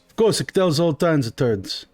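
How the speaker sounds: slurred "turns", words run together; marble-mouthed. Acted. Shopkeeper answering unhappy customer, marble-mouthed on "turns". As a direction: garble "all kinds of turns" as if round marbles. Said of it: "Get these marbles out of your mouth".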